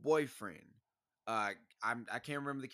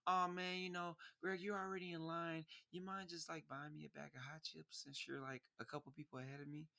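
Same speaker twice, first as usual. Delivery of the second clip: softer, higher flirty request; 4 semitones higher, 9 dB quieter than usual. Retold or imitated. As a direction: coax him sweetly for the chips.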